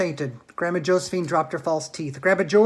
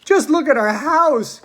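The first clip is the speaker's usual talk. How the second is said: higher, louder, slow wail; dismayed cry; 10 semitones higher, 10 dB louder, slower than usual. A narrator's reading. Mister Bucket crying over his ruined house, higher and louder. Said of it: "cried poor Mister Bucket".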